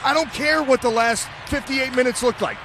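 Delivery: rally the team before the big drive; fired-up commentator. Real, not acted. Color commentator pumping up the team.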